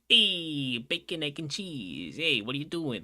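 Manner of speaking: loud, high, drawn-out "Hey" in a thick Italian accent; Italian-American accent. Shown as a demonstration. Streamer showing chat the accent he wishes he had, thick Italian. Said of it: "thicker Italian accent".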